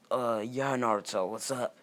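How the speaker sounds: a big, offhand hello across the room; loud casual greeting. Acted. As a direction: call it out loud and breezy. Obito greets Naruto, acted quote.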